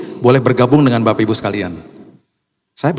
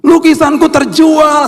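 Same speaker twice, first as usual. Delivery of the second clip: high, loud, stretched-out squeal; child's excited shout; 5 dB louder, slower than usual. Retold or imitated. Child whose painting sold, imitated loud high shriek. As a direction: shriek it slowly like an overjoyed child. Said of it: "dia teriak".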